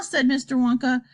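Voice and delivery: ordinary delivery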